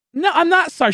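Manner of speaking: very high, sing-song refusal; mock defiant. Refusing to apologize to his friend's girlfriend, high and cheeky.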